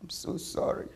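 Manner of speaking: hushed, choked little apology; tearful apology. Acted. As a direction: whisper it through welling tears. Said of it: "Her throat bubbled with tears".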